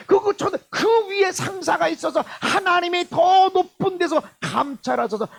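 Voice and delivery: high, driving sermon voice climbing as it lists; impassioned preaching. Real, not acted. Preacher to congregation on wrongs done by those above, impassioned. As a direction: preach it in one rising, urgent surge.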